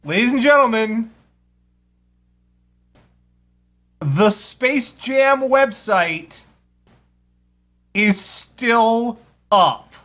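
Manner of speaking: slow, grand announcer reveal; slow hammed-up announcement; mock announcement. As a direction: present it like a big showbiz reveal. Announcing to ladies and gentlemen.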